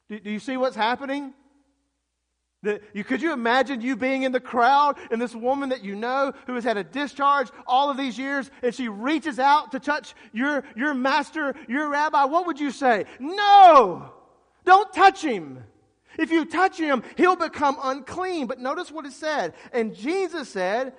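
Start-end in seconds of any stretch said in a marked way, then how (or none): 2.6-13.1 high, loud, urgent build; preacher building toward an outcry; impassioned preaching. Real, not acted. Preaches the scene with force. 13.2-18.5 very high, loud warning shout; panicked, drawn-out warning; alarmed outcry. Acted. Acting an alarmed bystander's outcry.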